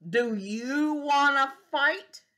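slow, loud taunting challenge; slow bossy bug voice; grouchy challenge. Acted. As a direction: drag out the dare. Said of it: "grouchy ladybug challenge".

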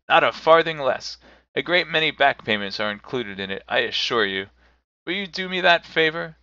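lightly voiced eager benefactor; mildly pressing, lifting into the request; insistent, generous Scrooge. A narrator's reading. Scrooge presses his gift on the gentleman, eager.

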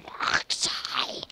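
breathy whispered name; hushed whisper. Real, not acted.

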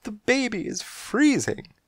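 high, slow worried mother voice; fussing mother cat. Acted. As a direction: fuss over the cold baby in a high voice. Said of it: "she hollered at Buster".

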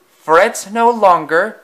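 high, slow, booming proclamation; a haughty engine's slow, lofty boast; grand declaration. A narrator's reading. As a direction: announce it like a pompous hero. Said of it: "declared eight seven five four six".

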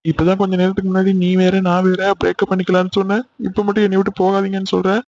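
soft, tense hushed talk; whisper-talk so mother won't hear; hushed panic. Acted. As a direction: lovers caught, hissing in hushed fear.

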